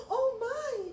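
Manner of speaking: high, loud startled cry; surprised exclamation. A narrator's reading. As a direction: burst out in shock at what he sees. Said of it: "exclaimed Pedro".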